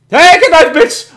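high, loud taunting yell; gloating shout. Real, not acted. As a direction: crow it at the enemy. Taunting a downed helicopter.

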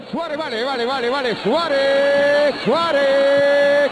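goal call building. Real, not acted. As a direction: build up the shout.

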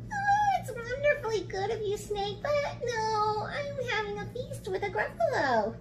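high, softly sing-song mouse voice; polite little mouse. Acted. Mouse politely turning down snake's feast, high and sweet.

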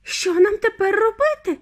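high, despairing wail; distraught high cry; wailing mother. A narrator's reading. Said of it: "голосила пані Тіві".

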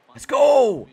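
high, loud celebratory shout; real pumped-up outburst; excited cheer. Real, not acted. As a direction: yell it in triumph.

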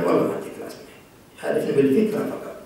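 whispered, as a petty man muttering to himself; mock spiteful whisper. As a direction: hiss it out under your breath, scornfully. Mocking a spiteful attitude.

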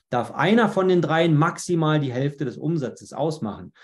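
ordinary talk, nothing marked

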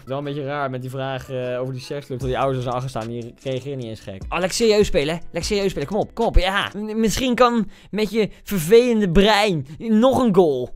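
normal talking voice

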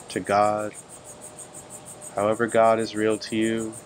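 calm, even meditation voice with long pauses; unhurried phrases, level in pitch, leaving long silences between them; guided meditation. Real, not acted. Teacher guiding listeners to breathe and turn within, calm meditation delivery.

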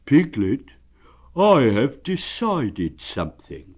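slow, grave and solemn. Acted. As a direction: announce it like a sacred vow. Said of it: "said in a very solemn voice".

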